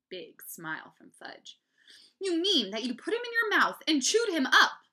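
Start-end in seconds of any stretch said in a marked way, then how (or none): 2.2-4.9 loud, high, disbelieving question; appalled. A narrator's reading. Mom checks whether Fudge chewed the turtle, appalled. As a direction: build in horror toward the end.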